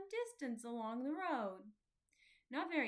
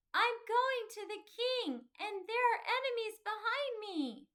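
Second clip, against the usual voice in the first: calling back; 10 semitones higher, 7 dB louder than usual. A narrator's reading. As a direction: shout the warning back without stopping. Faithful calls back over distance.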